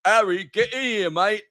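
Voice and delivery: high laddish mock-Ron voice calling a mate; Ron Weasley impression. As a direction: play a paunchy grown-up Ron hollering for Harry. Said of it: "fat middle-aged Ron Weasley being like".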